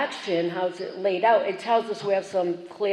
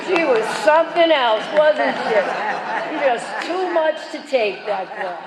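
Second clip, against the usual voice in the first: mock listener; 4 semitones higher than usual. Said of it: "and you go".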